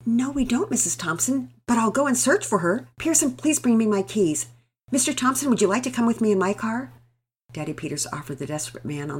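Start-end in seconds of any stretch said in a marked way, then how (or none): 0.1-6.9 a bit louder, hurried and resolute; urgent father. Acted. Neighbour father offering to search, urgent and firm. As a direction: take charge quickly in a crisis. Daddy Peters urgent reply.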